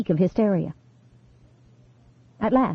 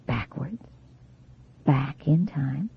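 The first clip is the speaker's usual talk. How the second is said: soft, slow whisper, almost no voice; hypnotic whisper; 6 semitones lower, 6 dB quieter, slower than usual. A narrator's reading. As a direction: breathe the words to lull her. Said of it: "unvoiced whisper".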